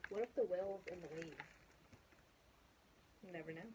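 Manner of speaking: ordinary delivery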